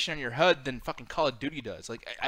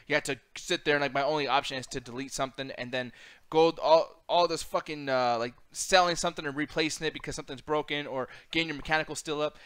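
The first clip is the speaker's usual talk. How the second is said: ordinary delivery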